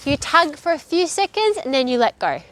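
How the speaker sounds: normal talking voice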